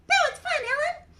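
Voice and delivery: high, loud puppet voice; delighted little character piping up; Mimi. Acted. Mimi praises the dog act to the storyteller, high puppet voice.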